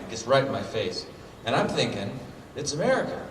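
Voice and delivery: normal talking voice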